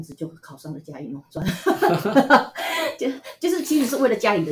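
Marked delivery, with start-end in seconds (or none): 1.5-2.9 bright burst of real laughter, higher; short amused laugh. Real, not acted. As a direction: laugh at herself. Laughs at her blind choice.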